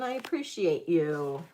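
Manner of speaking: normal talking voice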